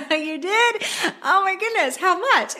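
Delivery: high, gushing, put-on surprise; mock excited. Mock-excited reply to husband's raise.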